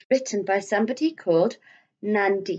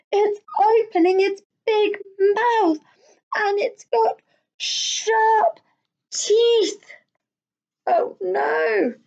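put-on frightened child; 12 semitones higher, 4 dB louder, much slower than usual. Scared child in story.

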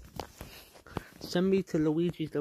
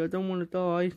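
ordinary talk

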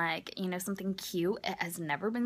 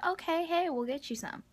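breezy dad; 9 semitones higher than usual. Retold or imitated. Dad agreeing to buy pants.

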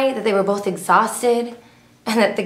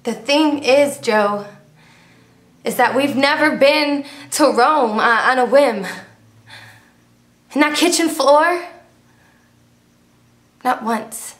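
higher-pitched, insistent complaint, falling off at the end; pointed confrontation; 4 semitones higher than usual. Retold or imitated. Woman retelling how she confronted Joe at home, insistent. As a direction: press the point home to a partner.

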